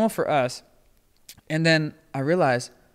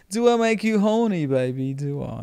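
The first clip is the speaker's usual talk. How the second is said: Austin Powers; 3 dB louder than usual.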